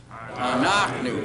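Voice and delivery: slow Anachnu, accent on NACH, not the ending; slowly modelling Anachnu for students to repeat; slow stress demo. Shown as a demonstration. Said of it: "Say that".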